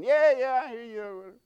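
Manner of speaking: mother shushing her son; impatient mom. Retold or imitated. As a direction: wave off the kid from the next aisle. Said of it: "I could hear Mom saying".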